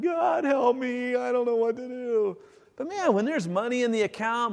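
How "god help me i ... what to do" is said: slow, high cry to God; hammed-up prayer for help; desperate plea. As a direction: call out to God in need. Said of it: "we cry out to God".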